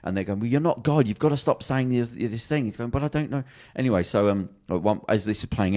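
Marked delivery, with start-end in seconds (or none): 0.4-2.6 higher-pitched retold scolding; exasperated confrontation. Mates in the pub telling him off, exasperated. 2.9-3.4 high-pitched retold plea; helpless protest. Man in hiding answering mates who doubt him, helpless.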